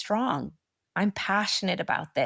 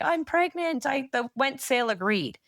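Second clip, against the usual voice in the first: excited news; 7 semitones higher than usual. Pretend. Imagined telling friend big news.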